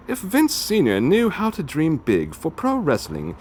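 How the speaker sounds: ordinary talk, nothing marked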